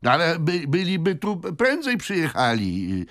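high, halting stableman voice; stammering stableman. A narrator's reading. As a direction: stumble over the explanation, high-pitched.

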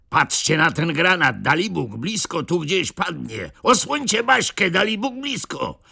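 rising high, frightened shout; Zagłoba alarmed, shielding Baśka; alarmed old man. A narrator's reading. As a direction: panic as the grenade falls. Grenade about to land.

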